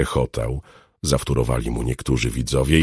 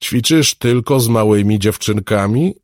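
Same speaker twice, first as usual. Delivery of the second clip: higher, louder taunting question; challenging; 8 semitones higher, 5 dB louder than usual. A narrator's reading. Muscular smuggler goads the alchemist into a fight, challenging. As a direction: provoke him with a loud, raised-pitch question. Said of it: "spytał wyzywająco".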